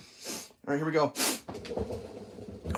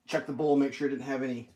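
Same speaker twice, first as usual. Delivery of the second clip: ordinary delivery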